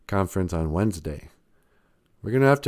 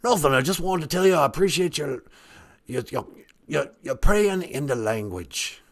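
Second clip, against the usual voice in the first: higher, far-off regal English lady voice; Queen of England voice; 4 semitones higher than usual. Acted. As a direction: speak as a grand queenly deity. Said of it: "you kinda sound like the Queen of England".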